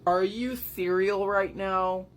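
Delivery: put-on baffled character voice; mock incredulous. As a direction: act out comic disbelief. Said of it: "Booth is like".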